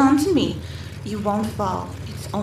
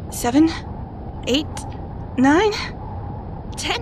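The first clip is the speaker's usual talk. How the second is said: urgent counting; 5 semitones higher than usual. Acted. Counting while falling.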